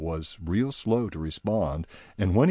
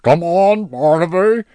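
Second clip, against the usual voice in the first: high, loud coaxing creature voice; High pressing Barnaby for gossip, loud and high; High, pushy; 11 semitones higher, 10 dB louder than usual. Acted. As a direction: wheedle the secret out of him. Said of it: "said High".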